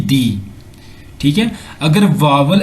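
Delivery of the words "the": "the" with a long ee vowel; THE as "dee". Shown as a demonstration. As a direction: say "the" as "dee", before vowel sounds. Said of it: "then pronunciation will be".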